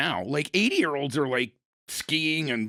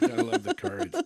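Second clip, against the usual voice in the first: mock squeal. Repeats joke, voice jumps up.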